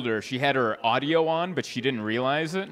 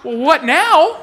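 much higher, louder, comic bewildered pause; 12 semitones higher, 9 dB louder, slower than usual. Pretend. Comic rioters inside the Capitol, high bewildered question. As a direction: ask it lost and blank. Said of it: "they're like".

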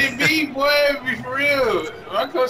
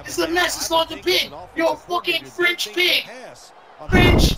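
higher mock British chef tirade; Gordon Ramsay impression; 7 semitones higher than usual. Gordon Ramsay berating the French restaurant owner in British accent. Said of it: "He was like".

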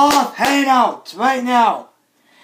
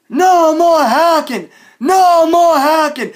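angry shouting; 5 semitones higher, 4 dB louder than usual. Acted. Raging at hackers.

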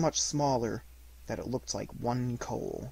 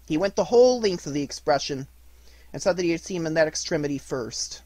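plain reading voice

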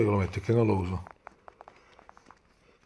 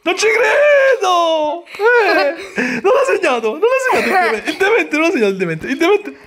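high, loud laughing disbelief; breathless italian goal commentary; 10 semitones higher, 3 dB louder, slower than usual. Real, not acted. Father celebrates opponent's miss.

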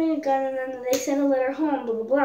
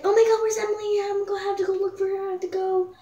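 higher, fast worried rush; panicky friend; 7 semitones higher, much faster than usual. Retold or imitated. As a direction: voice the friend's alarm, rushing. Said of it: "She's like".

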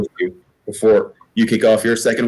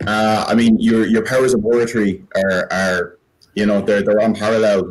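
usual speaking voice

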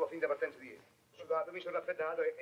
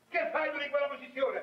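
scolding; 7 semitones higher than usual. Acted. Father scolding daughter.

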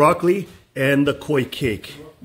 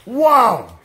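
amazed wow; 6 semitones higher, 8 dB louder than usual. Real, not acted. Reacting to the taste.

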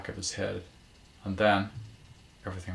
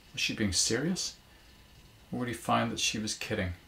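ordinary delivery, nothing marked

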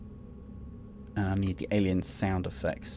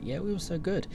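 mock smug voice; 10 semitones higher, 5 dB quieter than usual. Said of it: "Valve is like".

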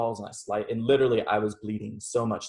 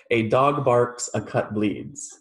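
slow, deliberate mantra; measured reading of a koan; slow koan recital; much slower than usual. Shown as a demonstration. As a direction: lay each word down slowly like a koan. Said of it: "This is your own little Zen koan for you".

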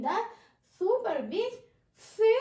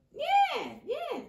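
higher little mouse puppet voice; Rosie the Mouse; 7 semitones higher than usual. Acted. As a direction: play a small, eager puppet mouse. Puppet mouse answers teacher.

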